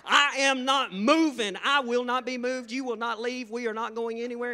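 hammed-up defiant shouting, high and loud; loud retold refusal, every word hit hard; arguing. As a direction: dig your heels in at full volume. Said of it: "me and her got in an argument".

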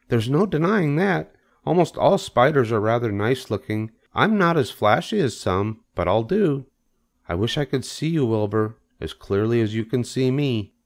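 high, composed spider voice; self-assured Charlotte. Acted.

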